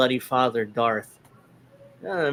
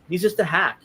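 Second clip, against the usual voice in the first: normal talking voice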